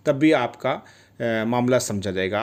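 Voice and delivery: ordinary delivery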